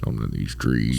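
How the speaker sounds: deep quiet growl; low gruff movie voice. Retold or imitated. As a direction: rumble it low like a soldier.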